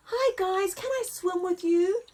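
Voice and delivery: chirpy and hopeful; friendly little giraffe. Acted. Giraffe asking the crocodiles to swim, cheerful. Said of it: "he asked the crocodiles".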